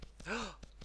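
sharp, high intake of breath; excited gasp. Real, not acted. Gamer spotting a dog, surprised gasp.

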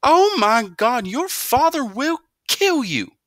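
very high, loud and slow wail; horrified cry. A narrator's reading. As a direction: cry out in horror at what he did. Mother horrified.

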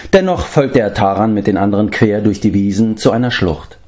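usual speaking voice

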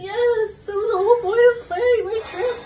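fast, tearful half-shout; crying outburst. Real, not acted. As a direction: sob out the complaint in a rush. Upset by the prank.

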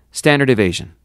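captain's order. A narrator's reading.

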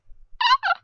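very high panicked laugh; a weird high squeal of a laugh; squeaky nervous laugh. Real, not acted. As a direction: let out a shrill nervous giggle. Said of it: "I'm making the weirdest sounds".

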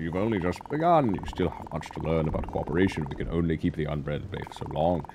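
low, grave narrator of a quest; wise mentor voice. Acted. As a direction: speak like a solemn sage. Voices game character dialogue.

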